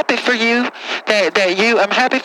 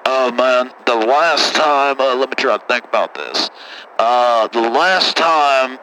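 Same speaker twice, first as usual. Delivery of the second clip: put-on much lower, rough-talking good ol' boy; deep caller voice; 9 semitones lower, slower than usual. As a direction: play a low-voiced country caller. Same caller voice, slowed.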